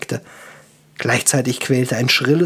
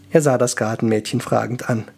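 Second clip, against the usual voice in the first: usual speaking voice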